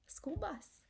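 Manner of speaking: secret unvoiced whisper of the answer. Real, not acted. Teacher revealing the answer, whispered. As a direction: let them in on a secret.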